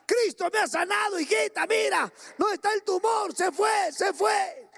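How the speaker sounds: high, loud ecstatic telling; overjoyed shout. Retold or imitated. Healed husband telling his wife the tumor is gone, overjoyed. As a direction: burst out with the miracle news.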